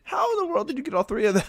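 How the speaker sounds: very high, fast disbelief; incredulous. Real, not acted. Co-host baffled by a listener's stacked roster, incredulous. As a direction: squeal the question, truly baffled. Baffled how one team has all three.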